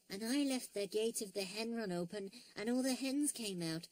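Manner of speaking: put-on high, soft little-girl character; small, high storybook voice; Tessie. A narrator's reading.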